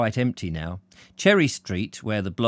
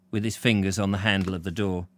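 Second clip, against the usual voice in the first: ordinary delivery, nothing marked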